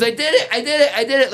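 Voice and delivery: high, laughing cry of triumph; kid's excitement. Retold or imitated. Student celebrating his VR baby delivery, giddy and laughing. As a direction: crow it out like a proud kid.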